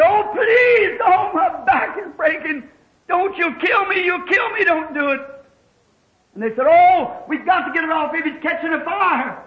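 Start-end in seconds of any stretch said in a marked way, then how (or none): 0.0-5.2 high, urgent retold cries of pain; high-pitched desperate begging; pleading in pain. Trapped boy's plea retold. 6.7-9.4 high, mildly alarmed retold shout; acted-out cry of alarm; urgent alarm.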